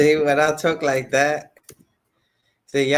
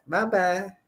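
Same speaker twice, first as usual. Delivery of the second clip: daughter's voice; 5 semitones higher than usual. Retold or imitated. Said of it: "I caught my daughter's spirit right there".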